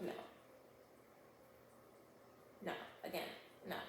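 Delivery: soft, breathy no, no, no; flat refusal. Retold or imitated. Police chief turning down Camille's plea, soft and dismissive. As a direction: brush her off under your breath.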